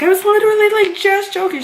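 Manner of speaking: very high, pleading retold protest; insistent protest. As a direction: re-enact pleading that it was a joke. Retold protest to offended supervisor.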